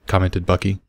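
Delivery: ordinary delivery, nothing marked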